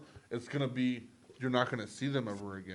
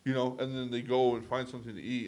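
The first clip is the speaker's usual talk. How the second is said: ordinary talk, nothing marked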